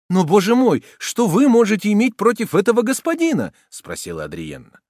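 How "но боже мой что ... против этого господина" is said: high, loud incredulous question; dismayed lady. A narrator's reading. Woman questioning the soldier about his enemy, dismayed. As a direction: ask it in high-pitched dismay.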